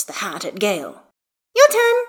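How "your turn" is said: put-on very high, loud little-kid command; demanding child. A narrator's reading. Hestia hands Gale the cowboy hat, high child demand. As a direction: pipe it up loudly like a bossy child.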